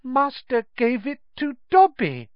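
high, awed elf voice; elf in wonderment. A narrator's reading. Dobby realises master gave it, in high-voiced wonder. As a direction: marvel at the gift, pitched far up. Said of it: "said the elf in wonderment".

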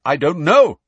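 flustered loud reply; shouted. A narrator's reading. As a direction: fling it back loudly. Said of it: "shouted Kingsley".

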